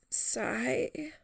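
a faint, breathy sigh, barely voiced; weak whispered sigh. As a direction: let out a tired sigh in a whisper. Injured Jamie exhales weakly.

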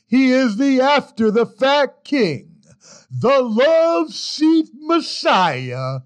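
high, slow booming announcer; grand announcer intro. Acted. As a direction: hype the intro like a ring announcer. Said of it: "And now for the ending outtake".

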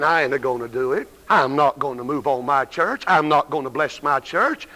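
mock God refusing his church, voiced low. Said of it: "God's sitting up in heaven that he's saying".

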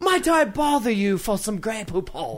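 shouted hammy upper-class voice; posh English voice. Pretend. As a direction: play a snooty aristocrat. Mock posh formal speech.

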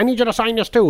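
a fan butting in at the table; pushy fan. Retold or imitated. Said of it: "was like".

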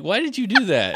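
high-pitched mock dismay; exasperated retold complaint. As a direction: protest in comic exasperation. Retold exasperated scolding of Stephanie.

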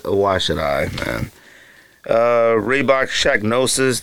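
ordinary talk

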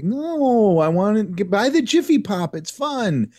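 put-on high pleading child; whiny kid voice. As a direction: beg like a little kid. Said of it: "I'd be like".

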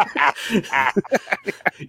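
real burst of laughter; laughing. Real, not acted. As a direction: genuine amused cackle. Laughs after insulting Hulk's worth.

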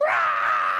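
loud imitated roar of a crowd. Preacher imitating the whole church jumping up, loud roar. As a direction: let out a big open roar.

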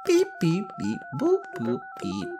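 beeping alien, pitch jumping up and down; alien robot voice. Pretend. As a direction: beep and boop like a little alien robot. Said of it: "we should say bye in, like, Alien".

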